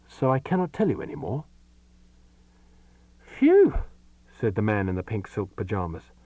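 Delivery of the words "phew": high, loud sigh of relief; loud relieved phew. Acted. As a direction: blow out the breath in relief. Man waking from a nightmare.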